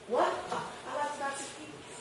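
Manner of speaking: soft, hesitant repeat, barely audible; timid mumble. Retold or imitated. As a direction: mumble like you doubt your own peas. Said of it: "or you're saying".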